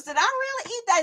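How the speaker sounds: high, quick, squeaky question; high-pitched comic impression; Urkel. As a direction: squeak it out fast in mock disbelief. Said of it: "You sound like Urkel".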